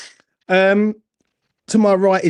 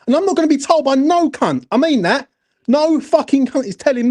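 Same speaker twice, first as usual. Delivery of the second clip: higher, fired-up, daring anyone to argue; heated rant; 7 semitones higher than usual. Real, not acted. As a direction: vent it all, pitch climbing high. Fan losing his temper on air.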